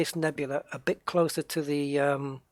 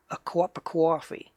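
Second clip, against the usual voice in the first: cup of coffee as "cwop a cwoffee", Boston or New York; Boston-style accent; 3 semitones higher than usual. Shown as a demonstration. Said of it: "is like a W in there".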